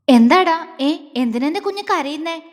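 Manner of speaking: a loud startled burst, then an anxious question; panicked concern; flustered worry. Acted. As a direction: rush in, worried for her. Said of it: "അവൻ വെപ്രാളപ്പെട്ടുകൊണ്ട് ചോദിച്ചു".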